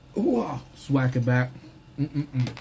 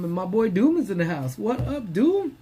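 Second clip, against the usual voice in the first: excited greeting; 7 semitones higher than usual. Real, not acted. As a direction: shout a happy hello. Greeting viewer joining live.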